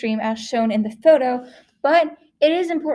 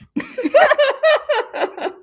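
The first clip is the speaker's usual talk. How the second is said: loud laughter; 13 semitones higher, 6 dB louder than usual. Real, not acted. Burst of laughter.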